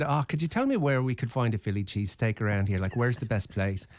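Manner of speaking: chipper put-on Irish brogue; spunky Irish accent. Asking two women at the bar, spunky Irish accent. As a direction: ask it as a playful fake Irishman. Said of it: "I was in the spunky Irish mood".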